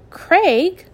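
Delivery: high, loud startled call; blurted out. A narrator's reading. Wife startled by her husband's offer, blurting. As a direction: blurt his name in shock. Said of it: "Bertha blurted out".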